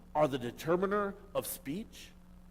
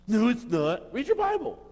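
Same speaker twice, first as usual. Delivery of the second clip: louder, higher burst of correction; sharp emphatic retort; 8 semitones higher, 6 dB louder than usual. Real, not acted. Preacher cutting off a false claim, loud and pitched up. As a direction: snap back at the idea.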